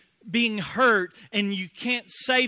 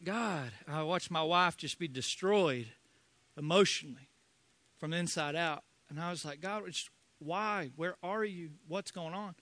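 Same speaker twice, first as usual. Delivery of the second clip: broken grief; 5 semitones lower, 9 dB quieter than usual. Real, not acted. Retelling grief after miscarriage.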